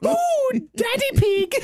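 very high, slow, breathy-free falsetto swoon; mock swooning Mummy Pig. Cohost playing Mummy Pig in a joke sketch, squeaky swoon. Acting Peppa Pig bedroom scene.

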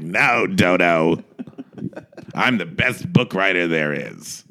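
low, slow self-important drawl; lofty and smug; pompous writer voice. Retold or imitated. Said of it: "Arthur Lawrence like".

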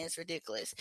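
usual speaking voice

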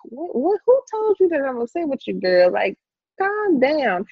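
high, fond, teasing reassurance; voicing God. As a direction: play God gently telling her to calm down.

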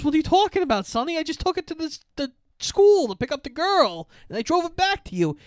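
very high-pitched pleading defence; acted frantic protest. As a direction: play a cornered kid explaining himself in a high voice. Acted frantic defence under attack.

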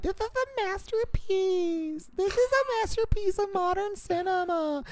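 mocking gushing critic.

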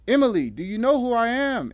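high, loud insistent question. A narrator's reading. Natalie questioning the revived Emily, insistent. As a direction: press her urgently for an answer. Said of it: "insisted Natalie".

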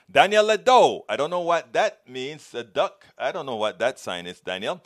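normal talking voice

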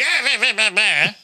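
high, nonsense nagging noises; mock griping. Mimics needlessly mean complaining.

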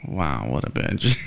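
low, slow deadpan judgment; acted dry disgust; unimpressed disbelief. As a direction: let it drop low and drag it out. Acted reaction to being lied to.

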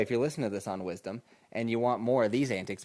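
ordinary delivery

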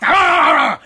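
high, loud yapping like a little dog; rapid burst of mock barks; imitated dog barking. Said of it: "and he barks".

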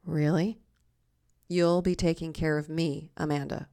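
deadpan sceptical echo, pitch back down; dry disbelief. Acted. Diner answering the phony server, dryly sceptical. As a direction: repeat it back unimpressed, letting the name fall.

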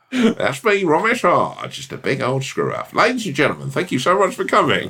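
very high, squeaky mock stage sign-off; hammed-up showman in a tiny voice. Mocking his show sign-off.

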